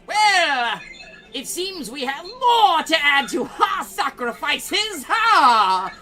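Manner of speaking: booming, high-pitched villainous shout with drawn-out words; echoing villain voice. Acted. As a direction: proclaim it loudly like a gloating villain to the whole woods. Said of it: "you all hear an echoing voice".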